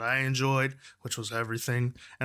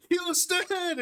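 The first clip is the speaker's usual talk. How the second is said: imitated rallying cry. Bandmate calling out to the cheering fans, excited. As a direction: imitate a triumphant call to a crowd.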